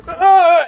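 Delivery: loud, high effort shout; straining yell. Acted. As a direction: heave with a straining cry. Straining to lift harpoon.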